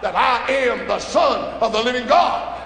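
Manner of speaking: ordinary delivery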